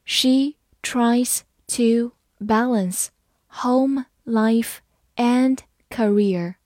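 very slow, word by word; slow reading. Shown as a demonstration. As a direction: read it slowly with a gap after each word. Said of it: "好，慢读一遍". (慢读，一词一顿)

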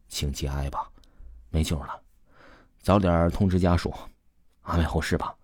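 hushed, low-pitched condolence; doctor softly breaking bad news; panting consolation. A narrator's reading. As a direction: deliver it low and subdued, consoling. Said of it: "喘着粗气儿安慰丽".

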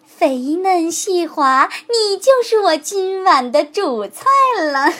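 慢慢地，得意地品味每个字; 像狐狸盯着猎物那样馋; 贪婪的狐狸. A narrator's reading. Fox gloats over his dinner. (慢悠悠得意的狐狸)

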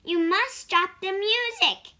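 tired mouse demanding the crickets stop, loud and firm; insistent mouse. Acted. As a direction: tell them firmly to stop. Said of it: "said the mouse".

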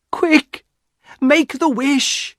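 high command, slowing on the wish; urgent cry. A narrator's reading. Wife sees paw in husband's hand, urgent cry. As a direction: cry out impatiently. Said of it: "and cried".

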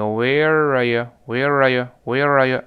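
"where are you" repeated as one smooth slurred word ending in "ya"; slow "where are you" with rolling joins and weak "you"; linked, glossed over. Shown as a demonstration. Teacher shows students how to glide past it, linked and weakened. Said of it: "这么一带而过".

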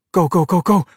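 high, urgent commands; urgent orders. Acted. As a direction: hurry them off. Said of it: "Daniela ordered".

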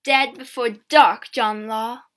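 slow, loud threat; angry calling. A narrator's reading. Girl cursing the peddler by name, calling. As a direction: call out the curse slowly and forcefully. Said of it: "she called".

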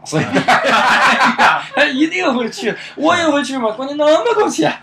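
laughing, loud and high; cracking up mid-sentence; 边笑边说. Real, not acted. 笑谈冠军奖金. (笑得停不下来地说)

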